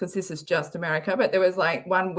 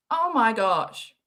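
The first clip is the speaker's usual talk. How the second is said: usual speaking voice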